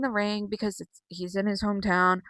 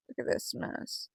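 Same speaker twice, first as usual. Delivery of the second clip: slow whisper; 10 dB quieter than usual. Real, not acted. Whispered again, slower.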